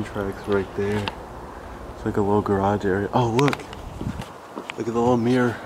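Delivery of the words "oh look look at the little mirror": usual speaking voice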